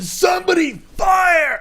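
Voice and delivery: high, drawn-out frantic shout; losing it. Retold or imitated. The operative panics and orders his men to fire, frantic high shout. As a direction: yell the order in panic, pitch way up. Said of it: "really lose his shit".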